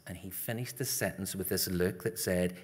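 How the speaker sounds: ordinary talk, nothing marked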